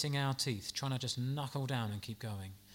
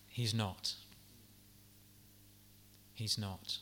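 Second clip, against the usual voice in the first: soft, lower, sincere assurance; gentle reassurance; 5 semitones lower, 8 dB quieter, slower than usual. Real, not acted. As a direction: quietly insist he is not. Reassuring repeat after rising build.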